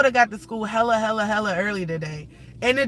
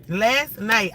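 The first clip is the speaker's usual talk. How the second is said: ordinary delivery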